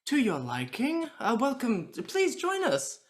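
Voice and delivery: high, gushing hospitality; effusive host, pitched well up; dazzling host. Acted. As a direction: usher the guest in with showy delight. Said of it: "being the dazzling host".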